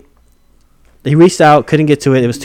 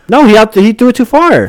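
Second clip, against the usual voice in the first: loud, high-pitched contradiction; raised voice, arguing back hard; heated rebuttal; 9 semitones higher, 5 dB louder than usual. Real, not acted. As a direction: shout down the objection. Heated dispute over the play.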